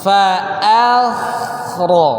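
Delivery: very slow "Fa akhra", a thin, kh thick; slow model fa akhra. Shown as a demonstration. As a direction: spell out each sound slowly. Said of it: "Jadi gimana?".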